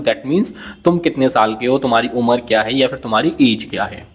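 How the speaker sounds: ordinary talk, nothing marked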